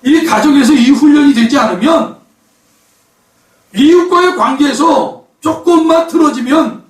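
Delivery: loud, high preacher's cry; forceful preaching. Acted. Preacher warning the congregation, loud and high. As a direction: hammer the warning home from the pulpit.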